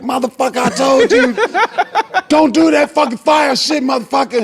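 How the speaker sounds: high, loud screaming host; host losing it, bellowing high and loud; furious yell. Retold or imitated. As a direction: yell it from the back of the room, enraged. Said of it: "The guy in the back like".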